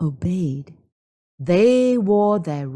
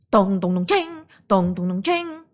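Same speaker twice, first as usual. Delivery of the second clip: pot banging sounds; 2 semitones higher than usual. Acted. Villagers banging pots.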